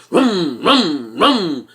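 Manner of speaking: low vroom, vroom, vroom; three low revs of a mimicked engine; imitated engine revving. Man telling how a driver revved beside him, imitated engine revs. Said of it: "revving his engine".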